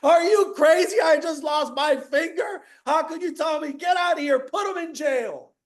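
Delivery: the king erupts at his advisor over his lost finger, shouting; furious king. Retold or imitated. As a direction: yell at him like an enraged king. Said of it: "The king's like".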